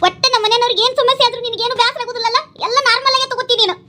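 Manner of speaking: high-pitched, upset accusation; hurt reproach. Acted. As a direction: reproach your husband for not caring. Wife reproaches husband in quarrel.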